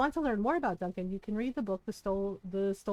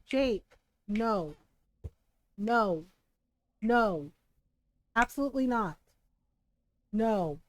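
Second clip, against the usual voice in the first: firm no; 4 dB louder, slower than usual. Real, not acted. Firm commands to dog.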